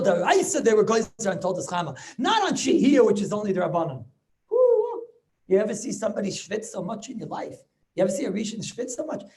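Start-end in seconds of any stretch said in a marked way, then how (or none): 4.5-5.0 a high, loud whoop of amazement. Real, not acted. Rebbe whooping at how hard the Rashba works. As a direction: whoop in awe.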